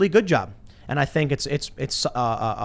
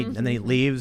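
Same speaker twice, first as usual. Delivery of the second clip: usual speaking voice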